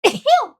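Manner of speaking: sudden loud sneeze. Real, not acted. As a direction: sneeze it out hard. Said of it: "Oh, I have to sneeze".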